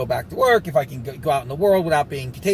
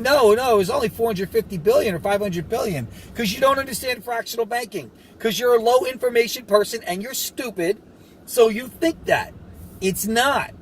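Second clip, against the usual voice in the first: mocking know-it-all; 6 semitones higher than usual. Mocking an uninformed viewer.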